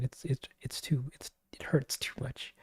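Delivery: pained little whisper. Real, not acted. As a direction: whisper it like it hurts. Complaining about opening the file.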